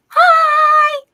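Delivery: high, loud squealed greeting; mock excited squeal. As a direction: shriek a delighted hi like an excited viewer. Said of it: "Shanana Erickson goes".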